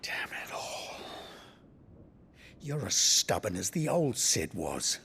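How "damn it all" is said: breathy whispered oath under the breath; a frustrated curse, whispered. Acted. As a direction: hiss it out in frustration. Muttered frustration alone.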